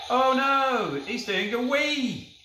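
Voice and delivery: mock alarm. Real, not acted. Toy dog wees on floor.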